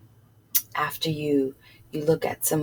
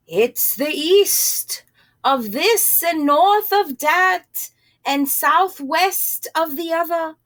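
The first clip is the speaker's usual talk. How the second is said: higher, slow, drawn-out cow; 9 semitones higher than usual. Acted. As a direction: muse it out slowly like a dreamy cow. Cow answers in a voice.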